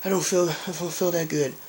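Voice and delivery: slow, dazed and queasy mumble; woozy cousin barely getting words out, slow and sick; sick stoned cousin. Retold or imitated. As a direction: drag it out like you're about to throw up. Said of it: "this is him".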